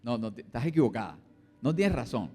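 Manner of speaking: husband denying a fault to his wife; acted denial. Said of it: "estado de negación".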